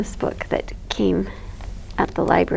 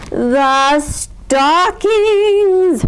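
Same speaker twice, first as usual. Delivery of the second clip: very slow, loud, stretched out; hint hard at the stockings; loud insistent; 8 semitones higher, 4 dB louder, much slower than usual. A narrator's reading.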